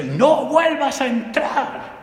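slow, high, loud hammed-up warning, laughing; mock shout. Teacher shouting slowly after the bumblebee, laughing. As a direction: bellow it slowly like a joke threat. Throwing bug out the window.

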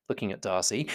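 ordinary delivery, nothing marked

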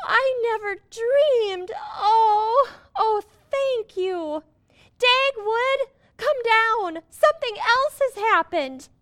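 high, tearful joy; overjoyed. Acted. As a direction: gush with happy tears. Overjoyed, near tears.

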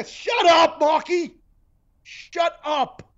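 high, loud, fed-up outburst; exasperated yell. Real, not acted. Host telling off Marky Mark, high exasperated yell. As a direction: yell it high at someone who won't stop.

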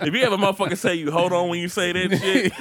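ordinary talk, nothing marked

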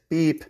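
whistle beep. Shown as a demonstration. Trainer showing the whistle cue, a high vocal beep. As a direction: squeak it out like a whistle. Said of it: "beep with the whistle".

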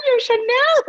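alarmed shout. Retold or imitated. Retelling to the co-host her shout on set, a high alarmed yell. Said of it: "Sabi ko".

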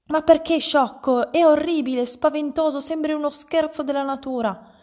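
high, loud, horrified rant; appalled scolding. A narrator's reading. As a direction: berate him in horror. Mother berates husband over hair. (rimprovero inorridito, a voce alta)

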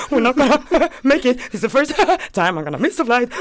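words broken up by giggles; retold nervous laughter bubbling through the line; stressed laughing. Stressed traveler to friend about missing flight, laughing. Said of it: "like, laughing. I'm like".

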